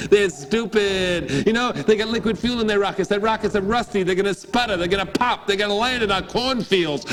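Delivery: mock the Russians' rockets; scornful Jack.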